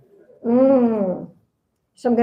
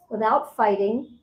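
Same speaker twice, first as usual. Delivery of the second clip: usual speaking voice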